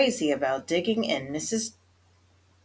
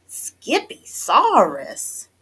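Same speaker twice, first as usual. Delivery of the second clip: slow, drawn-out, higher and louder; loud declaration; 5 semitones higher, 6 dB louder, much slower than usual. A narrator's reading. Mama repeats her kitty boy's word, declaring it slowly. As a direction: declare it in stretched-out disbelief. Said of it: "declared Mama Junebug Jones".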